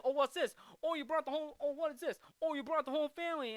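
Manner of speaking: high, hurried mock surprise; mocking taunt. Heckler taunting the golfer about his family, mocking. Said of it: "even Donald's like".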